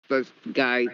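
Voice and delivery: usual speaking voice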